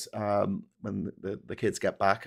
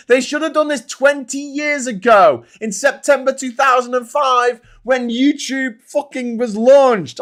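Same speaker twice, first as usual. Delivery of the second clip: louder and higher with each year; building rant; 12 semitones higher, 9 dB louder than usual. Real, not acted. Host venting to viewers about Democrats, shouting. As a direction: escalate into a full exasperated shout.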